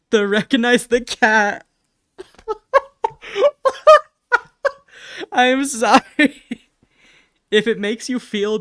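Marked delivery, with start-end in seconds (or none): 0.1-1.7 bursting out loud through laughter; loud laughing. Real, not acted. Streamer laughing at her own drawing, loud. 2.5-4.0 piercing squeal of laughter; high shrieking laugh. Real, not acted. Streamer shrieking with laughter at the drawing. 5.3-6.4 high, loud sorry through laughter; laughing apology. Real, not acted. Streamer apologizing to chat while laughing loudly.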